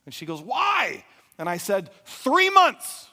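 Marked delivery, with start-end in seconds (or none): 0.4-1.0 high, loud acted yell; imitating a wife's frustrated outburst; exasperated demand. Wife demands a reason. 2.0-3.1 very high, very loud shout; full-blast acted outburst; exasperated yell.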